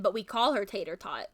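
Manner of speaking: normal talking voice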